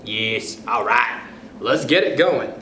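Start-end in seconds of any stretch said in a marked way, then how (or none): none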